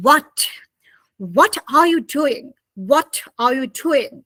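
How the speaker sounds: British what with a crisp, separate t; careful British pronunciation of what are you doing; British accent. Shown as a demonstration. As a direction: release the t in what, British style. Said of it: "But British people say".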